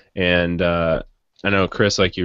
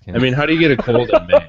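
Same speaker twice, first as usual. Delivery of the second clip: laughing disbelief; 7 semitones higher, 5 dB louder, faster than usual. Real, not acted. Joking disbelief about a cold.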